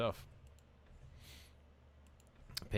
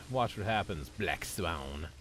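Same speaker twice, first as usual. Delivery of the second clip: menacing villain voice; slower than usual. Pretend. Threatening game opponent.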